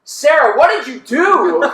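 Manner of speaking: mock outcry, high and loud; retold exclamation. Dallas confronts Sarah after being yelled at, mock outraged. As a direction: demand it loudly, drawing out the end.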